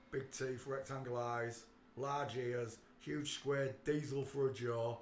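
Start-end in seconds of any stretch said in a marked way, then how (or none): none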